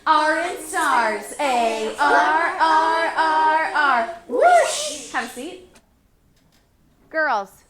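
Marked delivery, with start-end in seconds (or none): 0.1-5.1 loud sporty chant; basketball player. Shown as a demonstration. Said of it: "Be a basketball player".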